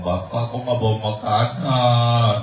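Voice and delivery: put-on a hungry little kid whining at his father; child's voice. Hungry child asks why father brought no food, high and slow.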